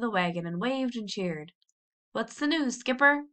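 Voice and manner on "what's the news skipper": higher call across to the dock; eager hail from the shore; shouted question. A narrator's reading. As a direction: shout it to the skipper from the bank. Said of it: "shouted someone on the bank".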